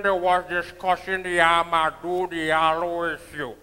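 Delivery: a hint of Sprangalang's grand deep delivery; Sprangalang impression. Comedian addressing the crowd, lightly doing Sprangalang. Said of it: "That is Sprangalang".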